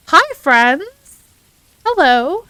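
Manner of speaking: loud, high, cheery hello; excited greeting. Real, not acted. Streamer welcoming raiders to chat, excited shout. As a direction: call out a big welcome.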